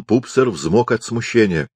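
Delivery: ordinary delivery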